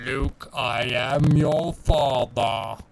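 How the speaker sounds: slow, booming Vader impression; Darth Vader voice. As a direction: deliver it like Vader, drawn out. Famous Star Wars line quoted.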